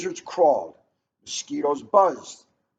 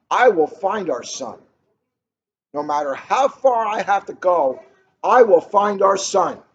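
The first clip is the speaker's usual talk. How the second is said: loud vow that grows louder, ending high; angry vow; 4 semitones higher, 8 dB louder than usual. Acted. Father vows to find his stolen son, building loud anger. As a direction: swear it with a raised fist. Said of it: "shook his angry fist".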